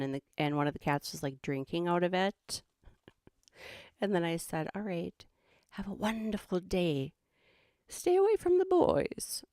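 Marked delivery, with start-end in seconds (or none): 4.8-9.4 Australian host sign-off, jumping high at the end; playful Australian farewell, ending high; mock Australian accent. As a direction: sign off like a hammed-up Aussie presenter. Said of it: "my attempt at an Australian accent".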